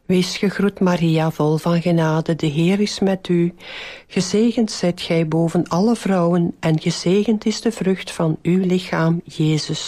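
even, measured rosary lead; devout, rote Hail Mary cadence; prayer recitation. Real, not acted. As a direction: recite a well-known prayer in steady phrases.

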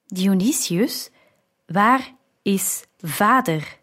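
slow, deliberate question with no affection; without affection. A narrator's reading. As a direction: ask it slowly, word by word, coolly. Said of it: "In zijn toon klonk geen spoor van genegenheid". (traag en zonder enige genegenheid)

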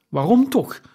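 high, loud cry of disbelief; exclaimed. A narrator's reading. Nephew exclaims to his uncle, high and loud. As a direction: cry out the question. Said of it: "riep Scrooge's neef uit".

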